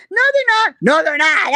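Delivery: shrill comeback belted out; mock shout. The woman loudly protesting at the town hall.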